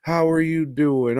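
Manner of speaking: put-on high, loud coo to a baby; baby talk. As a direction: play a dad greeting a crying baby. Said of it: "baby starts crying. He picks it up".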